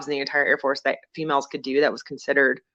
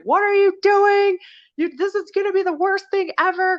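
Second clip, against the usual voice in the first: mock-yell like an appalled father; imitating mad dad; 4 dB louder than usual. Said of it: "My dad was so mad at me".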